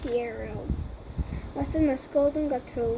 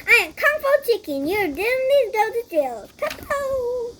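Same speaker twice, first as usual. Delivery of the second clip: higher, bold hero voice; heroic declaration; 7 semitones higher than usual. Acted. Kung Fu Chicken confronting the villain, higher heroic boast. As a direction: announce yourself to the villain.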